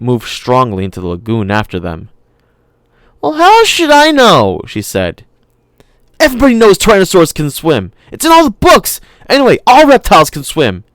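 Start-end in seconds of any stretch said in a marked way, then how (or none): 3.2-4.6 put-on high, loud little girl voice; a stubborn kid talking back; girl assuring her brother, voiced high; young girl. Lex voiced in the story. 6.2-10.8 put-on high, loud little girl voice; a stubborn kid talking back; girl assuring her brother, voiced high; young girl. Lex voiced, arguing.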